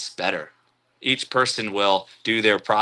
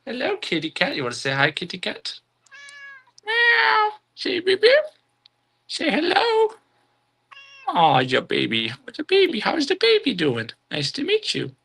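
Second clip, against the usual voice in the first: much higher cooing voice for a pet; sweet high pet talk to the kitty; baby talk. Pretend. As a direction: coo at the cat like a baby. Baby talk to her cat.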